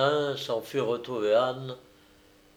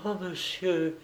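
joyful exclamation; 6 semitones higher, 5 dB quieter than usual. Acted. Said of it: "s'écria Yvette au comble de l'allégresse".

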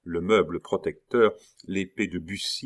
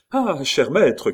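loud, high, drawn-out hail; raised, stretched-out call; 8 semitones higher, 7 dB louder, slower than usual. A narrator's reading. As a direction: cry out to your master. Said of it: "cria une voix bien connue".

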